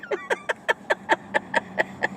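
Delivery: high breathless laugh. Real, not acted. Laughing about her Italian family.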